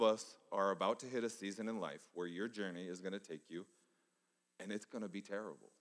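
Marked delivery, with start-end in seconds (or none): 4.6-5.7 stack each line higher toward a peak; building preaching. Acted. Pastor warns the congregation of hard seasons, rising preaching build.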